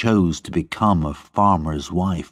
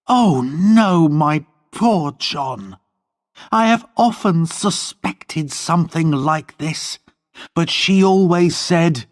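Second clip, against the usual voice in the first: put-on higher, pleading young woman; Lorna's voice; 10 semitones higher than usual. A narrator's reading. Lorna pleading with John, higher young woman's voice. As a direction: plead with him as Lorna, voice lifted.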